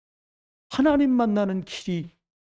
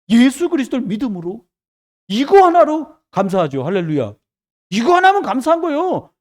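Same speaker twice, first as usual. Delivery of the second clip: raised, loud sermon voice; full-force preaching; 4 semitones higher, 6 dB louder than usual. Real, not acted. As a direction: preach it out to the whole congregation.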